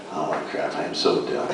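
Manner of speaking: soft, breathy self-scolding mutter under the breath; mock muttered panic. Voice actor muttering at himself over Japanese names, soft and dismayed. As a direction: quietly curse yourself mid-line. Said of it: "and you're going".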